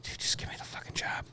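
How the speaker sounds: hushed, whispered mock begging; whispered plea. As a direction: whisper it like a desperate aside to the interviewer. Retold desperate plea to interviewer.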